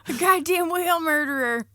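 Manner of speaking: high, loud shocked outrage; mock outrage. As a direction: act appalled at him.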